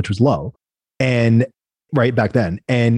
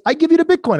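high, sing-song Italian voice for the client; playful high Italian impression; imitating Italian accent. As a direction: mimic the Italian client's broken English, pitched up. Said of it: "he was Italian".

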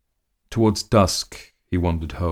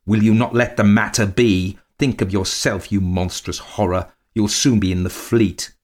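raised voice, overwrought anger; raging; 3 semitones higher, 5 dB louder than usual. A narrator's reading. Man threatening his wife with debtors' prison, raging. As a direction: rage at her, nerves frayed. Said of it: "He raged at her".

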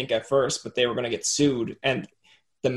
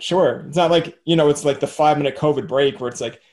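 normal talking voice

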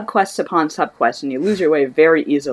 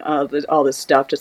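usual speaking voice

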